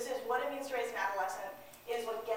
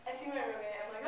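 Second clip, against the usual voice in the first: normal talking voice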